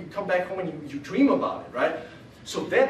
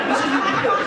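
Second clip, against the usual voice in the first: breathy laughing disbelief, higher and louder; laughing through it; 7 semitones higher, 6 dB louder, faster than usual. Real, not acted. On the bus, cracking up at the friend's reply, laughing. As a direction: barely get the words out for laughing.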